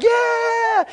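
high, drawn-out cheer; long high-pitched group yeah; crowd of excited kids. Retold or imitated. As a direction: cheer like a roomful of middle schoolers. Kids cheer back to the director.

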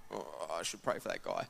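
low, soft inner voice, as if thinking aloud; inner thought. Retold or imitated. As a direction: mutter the sudden urge under your breath. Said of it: "that unction to pray for him".

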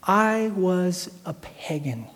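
slow, drawn-out, softened admission; grave confession. Real, not acted. Preacher confessing his past to the congregation, slow and grave. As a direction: stretch each word out and let it land.

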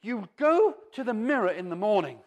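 ordinary delivery

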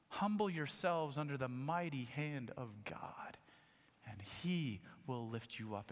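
soft, reverent whisper. Acted. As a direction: hush it like a sacred secret. Repeats the verse, hushed.

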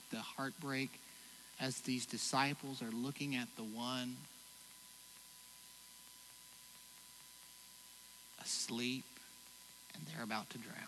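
soft, low, grieving confession; hushed, heavy sermon reflection; quiet sorrow. Real, not acted. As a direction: let the heartbreak sink in quietly. Grief over father's illness.

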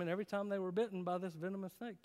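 ordinary talk, nothing marked

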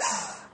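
sharp acted intake of breath; panicked gasp. Parent realizing the children are leaving, panicked gasp.

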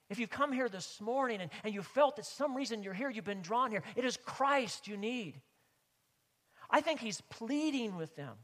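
loud, high pleading sermon; preacher urging the listeners to come to Christ; impassioned preaching. Real, not acted. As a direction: plead with the congregation at full force. Preaching at full force.

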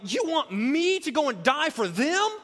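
mock-indignant question; mock complaint. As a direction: voice the imagined protest. Imagined Jesus complaining.

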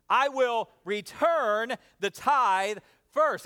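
slow, drawn-out chant with the crowd; slow unison recital. Shown as a demonstration. Congregation reciting with the pastor, slow. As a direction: recite it together slowly. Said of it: "Say that with me".

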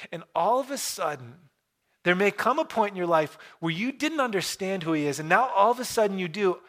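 ordinary talk, nothing marked

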